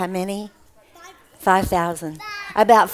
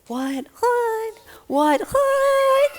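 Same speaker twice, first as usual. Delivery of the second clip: very high squeaky puppet voice; high-pitched, drawn-out bewilderment; baffled puppet; 12 semitones higher, much slower than usual. Acted. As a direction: squawk the confusion in a silly puppet voice. Puppet plays baffled disciples.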